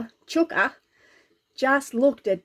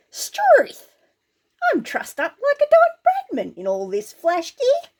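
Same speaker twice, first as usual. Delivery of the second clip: dismayed exclamation; 12 semitones higher than usual. Acted. Dave stuck in heavy armour.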